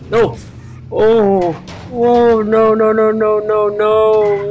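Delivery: rising panicked no, no, no; dismayed cries. Real, not acted. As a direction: yelp as the car gets wrecked. Car crashes in game, dismay.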